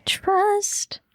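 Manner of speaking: high airy whisper. Pretend. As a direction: breathe it out like a secret. Echoing in mock mystical way.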